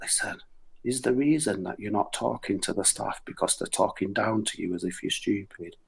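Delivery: very soft, hushed retelling; really quiet. Retold or imitated. To a non-verbal co-worker, a hushed quiet question. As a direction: keep it low and gentle so only he hears. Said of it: "really quietly said to him".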